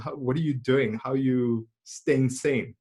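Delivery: ordinary delivery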